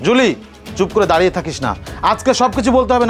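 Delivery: ordinary delivery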